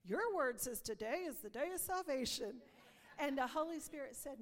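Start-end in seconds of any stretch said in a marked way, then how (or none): 0.0-2.6 protesting surprise. Retold or imitated. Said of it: "And I was like".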